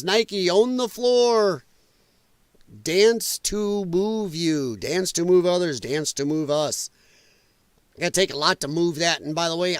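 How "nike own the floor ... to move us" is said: slow, drawn-out commercial voice; ad slogan read. Pretend. Host mocking a Nike ad to viewers, slow ad read. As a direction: read it like a hammed-up sneaker ad.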